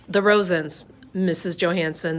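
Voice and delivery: usual speaking voice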